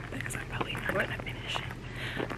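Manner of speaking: whispered aside; whispering. Real, not acted. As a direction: hush it under breath.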